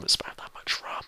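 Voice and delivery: whispered aside. Real, not acted. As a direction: breathe it under your breath. Aside about rum.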